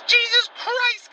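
frustrated cursing. Acted. Cousin cursing his changing voice after singing, frustrated. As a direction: spit out each curse separately in exasperation.

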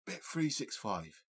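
slow, drawn-out East End Cockney; London Cockney ad impression; heavy Cockney accent. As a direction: imitate a Cockney advert voice. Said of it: "of London Cockney accent".

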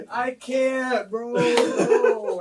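laughing hard. Real, not acted. Cracking up.

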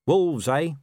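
lightly indistinct, through roast chicken; indistinct speech. A narrator's reading. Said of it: "he said indistinctly".

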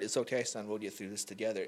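a hint of a soft reassuring father; gentle comfort. The Lord comforting him, softly reassuring. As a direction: ease the words out tenderly. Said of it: "I just heard".

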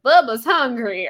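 slow, loud, hammed-up Southern drawl; husband's goofy Southern Bubba voice; bad Southern accent. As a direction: play a backwoods kid, badly faked Southern. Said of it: "he's not good at doing a Southern accent".

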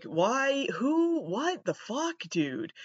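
high, incredulous why-me outburst; mock bewildered cry of disbelief; exasperated disbelief. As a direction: throw up your hands at past mistakes. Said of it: "and I'm just like".

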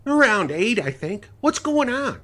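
high, loud bewildered woman; groggy woman voice. Acted. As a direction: answer half-awake and confused. Sally voiced.

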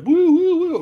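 very high, loud siren wail; cartoon siren woo-woo; mock siren. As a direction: imitate a squad-car siren. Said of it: "Everyone's like".

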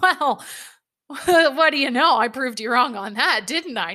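high, loud, laughing comeback; laughing retort. Retold or imitated. Teasing her grandfather after being bucked, laughing. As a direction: laugh through the teasing reply. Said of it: "And I'm like".

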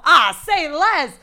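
loud, slow drawn-out boast; cocky. Retold or imitated. Retold cocky answer, loud.

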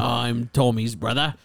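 put-on slow Cockney accent; Cockney Arthur. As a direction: introduce yourself in drawn-out Cockney. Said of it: "My name's Arthur".

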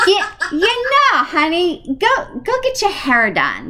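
high, hammed-up New England accent. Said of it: "Here comes my New England accent".

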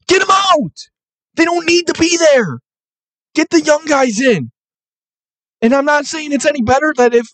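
exasperated rant. Real, not acted. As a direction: vent in disbelief, pitch climbing.

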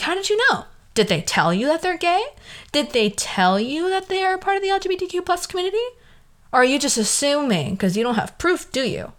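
high, rising pointed questioning; mock interrogation.

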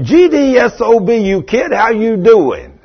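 high, loud gruff greeting from a tough guy; rough old cusser. Retold or imitated. Preacher imitating the rough neighbor's loud, high greeting. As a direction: bark it out like a rough, tough lost man. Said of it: "that's the way he talked".

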